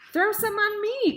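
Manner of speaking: high, loud excited shout; boy's eager cry. A narrator's reading. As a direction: beg loudly like a hot kid. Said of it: "cried Avery".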